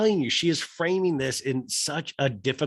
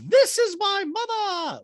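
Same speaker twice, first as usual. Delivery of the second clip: very high squeaky voice with beep boop robot noises; high mock robot Dorit; 5 dB louder, slower than usual. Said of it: "And Dorit goes".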